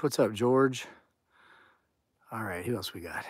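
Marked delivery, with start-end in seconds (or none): 2.3-3.2 whisper. Real, not acted. Whispered aside reading comments.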